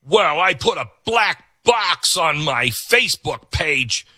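hint of a proud do-gooder, mocked; mock self-satisfied activist. Mimicking a self-satisfied online activist.

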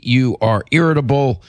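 ordinary talk, nothing marked

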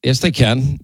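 ordinary talk, nothing marked

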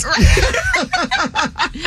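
a high burst of real laughter. Real, not acted. Laughing at his own mistake while telling friends.

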